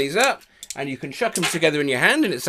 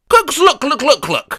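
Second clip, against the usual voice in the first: magnet noise; 9 semitones higher, 4 dB louder than usual. Shown as a demonstration. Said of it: "One more time, you ready?".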